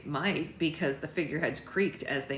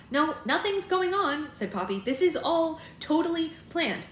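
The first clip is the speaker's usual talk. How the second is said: forced cheerful; 9 semitones higher, 4 dB louder than usual. A narrator's reading.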